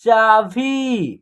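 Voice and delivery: चाभी very slowly, both vowels held; long vowels. Shown as a demonstration. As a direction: stretch the final ī of चाभी. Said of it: "खींच करके होगा".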